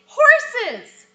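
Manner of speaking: high, loud blurted exclamation; sudden loud outburst; excited shout. Shown as a demonstration. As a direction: burst in with it. Child excitedly interrupting.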